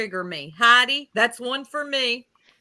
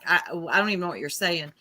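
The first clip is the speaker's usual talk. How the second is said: fast flustered; 4 semitones lower, much faster than usual. Retold or imitated. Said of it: "I'm like".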